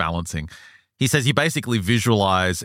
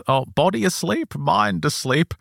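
higher, lightly voiced old lady; airy little old lady, pitched up; elderly woman; 10 semitones higher than usual. Retelling Anne's account, lightly voiced old woman. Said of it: "And she just says".

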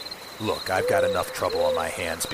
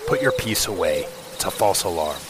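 lightly voiced gruff old cop; a hint of a stern beat cop; 1930s beat cop; 5 dB louder than usual. Plays a gruff police commander.

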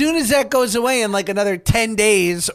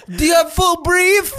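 shouting; 9 semitones higher, 6 dB louder than usual. Real, not acted. Shouting the question through laughter.

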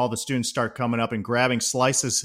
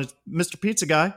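higher, cheerful call ending on a rise; pizza guy call; 6 semitones higher than usual. Retold or imitated. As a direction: announce yourself brightly from outside the door. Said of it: "standing outside going".

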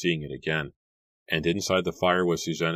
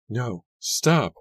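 high loud plea to stop; pleading cry; 7 semitones higher, 5 dB louder than usual. A narrator's reading. Mary begging Jeremy to stop, loud high plea. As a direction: cry out to make him stop.

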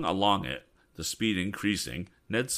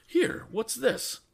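very high, slow, alarmed question; startled cry; much slower than usual. Acted. Ned startled as the windshield slides down, high and slow. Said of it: "cried Ned".

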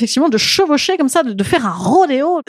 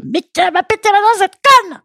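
higher, mock outraged yell into the mic; angry friend; 8 semitones higher than usual. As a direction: imitate the friend screaming after the broken tooth. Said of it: "elle a dit au micro".

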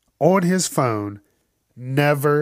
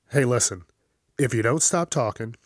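normal talking voice

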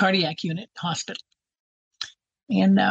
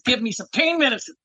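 mock demanding; 6 semitones higher than usual. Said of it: "I was getting a little violent".

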